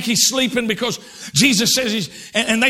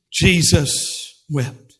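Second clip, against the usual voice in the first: lower, very slow "Jesus wept"; solemn and slow; 6 semitones lower, much slower than usual. Acted. Preacher pausing on the verse: slow solemn read. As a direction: let it hang heavy.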